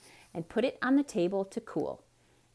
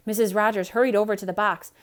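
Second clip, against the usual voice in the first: usual speaking voice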